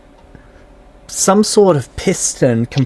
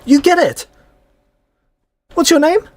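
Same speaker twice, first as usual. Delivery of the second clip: high NPC voice; 13 semitones higher than usual. Acted.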